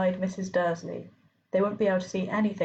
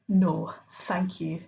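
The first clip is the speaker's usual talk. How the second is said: slow, cold refusal; much slower than usual. A narrator's reading. Professor refusing a sweet, slow and cold. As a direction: turn it down slowly and coldly. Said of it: "said Professor McGonagall coldly".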